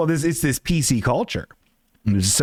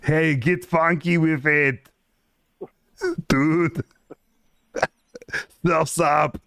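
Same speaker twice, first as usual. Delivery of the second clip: slow, hammed-up Arnold voice; same Arnold voice passed off as someone else; Arnold-style character; 2 semitones higher, slower than usual. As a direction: stretch it out in a badly faked Arnold accent. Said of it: "it's always just Arnold's voice".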